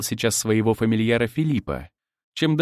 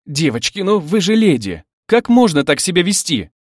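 higher, louder scandalized plea; exasperated protest; 7 semitones higher, 6 dB louder than usual. A narrator's reading. Husband scolding three squabbling brides, exasperated protest. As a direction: appeal to their manners, pitch lifted.

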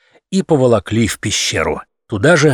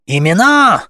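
louder, higher crowd demand; indignant roar; 8 semitones higher, 4 dB louder than usual. A narrator's reading. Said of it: "взревела возмущённая толпа".

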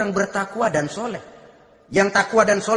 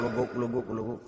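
soft bubbling imitation; boiling water sound; 8 semitones lower, 10 dB quieter than usual. Preacher imitating the boiling pot, soft bubbling. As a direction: quietly mimic the pot bubbling. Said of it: "bunyi air ngegulak".

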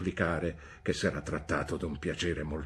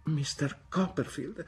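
slower, higher, wounded start; the mother defends her dead husband; hurt retort begins; 8 semitones higher than usual. A narrator's reading. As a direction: draw yourself up to reply. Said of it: "replicò mia madre".

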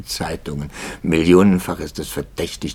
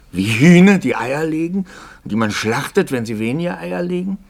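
erregt; 10 semitones higher, 7 dB louder than usual. Acted. Pfarrer redet sich in Erregung.

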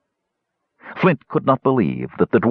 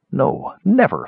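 vehement; 6 semitones higher than usual. A narrator's reading. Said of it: "Flint repeated vehemently to himself".

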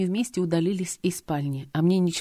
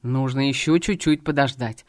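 low, strained through clenched teeth; through gritted teeth; 4 semitones lower than usual. Acted. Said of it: "процедил он сквозь зубы".

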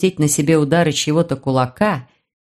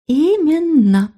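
high, slow little fairy voice; a smug little fairy confirming; 8 semitones higher, much slower than usual. Acted.

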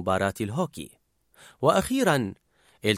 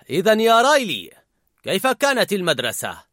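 louder, higher dad voice, awkward; 6 semitones higher, 4 dB louder than usual. A narrator's reading. The father asking Riley about school, awkward. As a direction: ask it with forced cheer.

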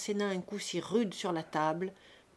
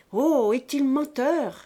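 slow, loud, higher mock-innocent protest; innocent air; 5 semitones higher, 8 dB louder, slower than usual. A narrator's reading. The brat protests innocence to the adults, hammed-up innocent. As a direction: play the innocent, drawn out. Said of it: "d'un grand air innocent".

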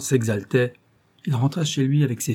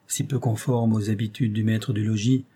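usual speaking voice

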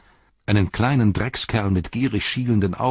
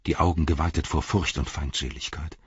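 ordinary delivery

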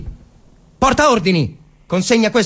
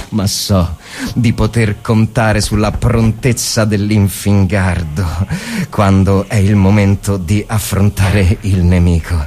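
inner monologue; 11 semitones lower than usual. Acted. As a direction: think aloud in the dark trench.

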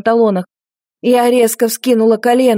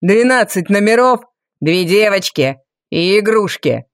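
insistent. A narrator's reading. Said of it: "с нажимом произнёс Валериан".

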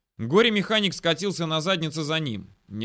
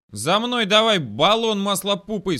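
loud, high, drawn-out angry yell; breaking into a squeal; 7 semitones higher, 8 dB louder than usual. A narrator's reading. Technician ordering the mechanic after him, squealing in anger. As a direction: voice shoots up in fury. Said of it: "сорвался на визг технарь".

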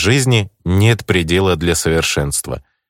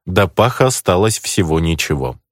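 ordinary delivery

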